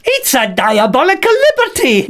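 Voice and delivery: high indignant puppet voice; Bert voice. Acted. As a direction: huff like an outraged little bag. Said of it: "You sound just like Freddy".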